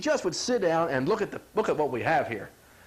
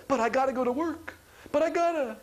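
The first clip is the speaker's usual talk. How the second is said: whiny protest; 7 semitones higher than usual. Retold or imitated. Person protesting to body.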